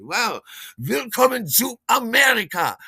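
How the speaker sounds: high, loud German pronunciation; hammed-up welcome in German; showy German greeting. Storyteller welcoming a German crush, showy high German greeting.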